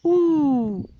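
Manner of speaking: "ou" alone, long, high and loud; "ou" as one stretched pure vowel; vowel sound isolated. Shown as a demonstration. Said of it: "on entend".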